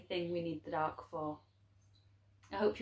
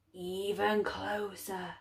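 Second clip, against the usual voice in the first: slow, softer, creaky dark; slow hushed beckoning from the dark; 6 dB quieter, much slower than usual. A narrator's reading. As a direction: coax him near, slow and cold. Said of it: "The voice of the dark was as creaky".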